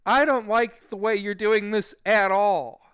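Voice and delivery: high, loud, slow protest; re-enacting his anxious stand; nervous objection. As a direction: draw out the complaint, rattled but standing up. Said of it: "I was nervous".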